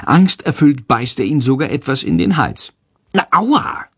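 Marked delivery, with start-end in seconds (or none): 3.1-3.8 high yelp of pain; startled ouch, pitched up but not full volume; crying out. A narrator's reading. Boy gets bitten by his bat, yelping high. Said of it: "schreit Jendrik laut".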